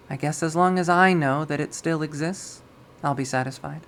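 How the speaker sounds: ordinary delivery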